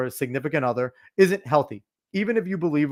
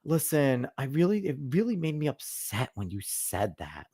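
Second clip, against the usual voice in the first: softer mock of a careful partner; mocking soft talk; 8 dB quieter than usual. Husband mocking how couples supposedly talk, softer and prissy. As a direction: send up the tiptoeing partner, softer. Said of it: "You don't sit there and go".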